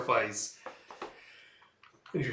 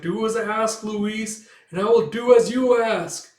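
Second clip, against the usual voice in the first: monster's entreaty; 8 semitones higher, 7 dB louder than usual. Acted. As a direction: call after the fleeing child, stretching words. Monster bargains with fallen child.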